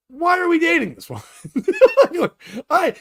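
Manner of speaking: high, laughing disbelief; laughing question. Real, not acted. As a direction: ask it while cracking up.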